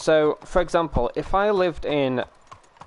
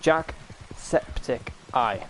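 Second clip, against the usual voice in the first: Jacksepticeye drawn out syllable by syllable; Jack-sep-tic-eye, very slow; slow careful name; 3 dB quieter, much slower than usual. Shown as a demonstration. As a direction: spell Jacksepticeye out slowly. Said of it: "struggled saying his name".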